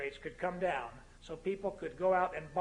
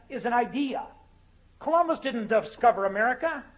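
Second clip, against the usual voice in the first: high, loud stump-speech delivery; impassioned oratory; 6 semitones higher, 8 dB louder than usual. Real, not acted. Speaker urging Harvard audience to get it right, impassioned oratory. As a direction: ring it out to the whole hall. Orates at full force.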